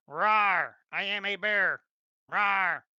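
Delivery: hammed-up bear roaring. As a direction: growl it out like a silly bear. GM voicing the summoned bear.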